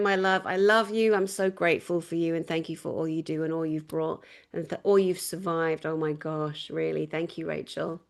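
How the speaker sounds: normal talking voice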